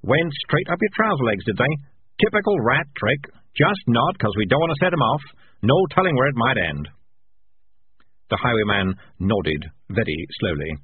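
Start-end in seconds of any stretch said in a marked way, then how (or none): 0.0-6.9 higher, cheery cat in control; cheerfully. A narrator's reading. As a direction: chat merrily to the trapped victim. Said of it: "said Morris cheerfully".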